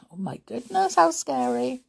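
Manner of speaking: high, playful alarm; mock fright. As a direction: pretend to be scared by the story. Narrator reacts in mock fright.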